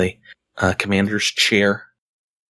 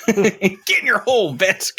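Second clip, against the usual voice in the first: laughing; 12 semitones higher than usual. Real, not acted. Laughing mock command to player.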